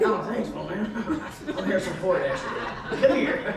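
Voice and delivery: lightly voiced movie star, half laughing; loose Harrison Ford impersonation. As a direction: play the famous actor loosely, amused. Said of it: "He doesn't sound like that".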